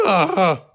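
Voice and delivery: short high yelp of frustration; frustrated scream. Acted. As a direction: burst out in exasperation. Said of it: "Bob screamed".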